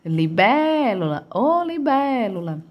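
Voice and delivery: "Libélula" called with a long BÉ; dragged stressed syllable. Shown as a demonstration. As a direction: stretch the second syllable of "Libélula" as if calling it. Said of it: "a sílaba que mais se arrasta é a bé".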